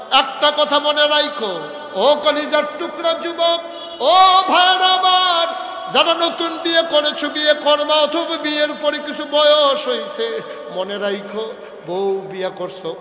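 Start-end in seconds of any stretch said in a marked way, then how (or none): 0.1-10.4 higher, louder appeal; preacher pleading with newlywed men; impassioned preaching. Acted. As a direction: call out to the young husbands. Preacher addresses young men.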